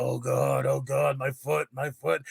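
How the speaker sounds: mock groaning, slightly subdued; acted suffering patient; moaning in pain. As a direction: moan it pitifully to yourself. Acting out his moaning.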